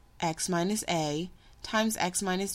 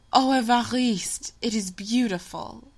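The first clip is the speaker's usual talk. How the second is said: louder, higher burst of delight; delighted exclamation; 4 semitones higher, 3 dB louder than usual. A narrator's reading. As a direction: gasp out her joy at the gift. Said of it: "she exclaimed".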